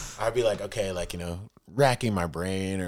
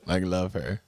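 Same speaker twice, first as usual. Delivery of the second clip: usual speaking voice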